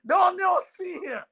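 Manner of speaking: hammed-up Jamaican mother yelling at a C grade; outraged mother shouting. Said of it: "She goes".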